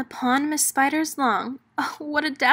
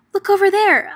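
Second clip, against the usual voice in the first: high, loud excited cry; excited call; 9 semitones higher, 9 dB louder, faster than usual. Acted. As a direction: call out to point something out, thrilled. Character calls out excitedly.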